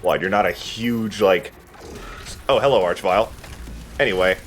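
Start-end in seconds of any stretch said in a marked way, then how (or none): none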